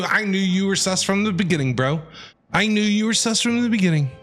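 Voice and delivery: ordinary talk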